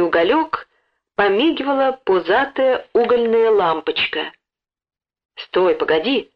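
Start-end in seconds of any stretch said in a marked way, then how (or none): none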